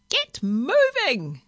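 slow, high, loud squawk; parrot screech. A narrator's reading. Parrot ordering the bus driver to go, screeching. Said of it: "it screeched".

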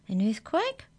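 high, startled gasp; gasped question. A narrator's reading. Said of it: "gasped Mallory".

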